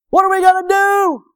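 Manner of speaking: high, loud, frantic cry; the servant losing his nerve; high frightened wail; panicking. Acted. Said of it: "He's panicking".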